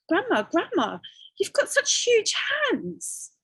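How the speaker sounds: put-on a girl gasping at grandma's hands; girl's high voice.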